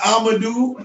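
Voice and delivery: "Amadou" slowly, no H, long oo; mispronounced name. Shown as a demonstration. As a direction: draw out "Amadou" with an oo ending. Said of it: "Not Amadou".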